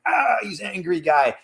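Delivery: high cartoon grumble of an angry driver; Goofy turned angry driver. Cartoon dog gets in his car, angry voice. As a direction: play the cartoon guy gone grumpy at the wheel. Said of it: "gets in the car and he's like".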